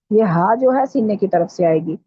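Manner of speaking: usual speaking voice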